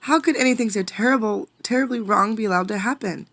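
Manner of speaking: fast, high, anguished plea; despairing. A narrator's reading. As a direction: pour out the despair. Despairing question.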